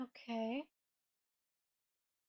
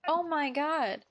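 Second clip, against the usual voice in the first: loud, higher, incredulous complaint; exasperated; 4 semitones higher, 6 dB louder, much slower than usual. Real, not acted. Player sick of a ranting doomsayer, exasperated. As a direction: raise your voice in fed-up disbelief.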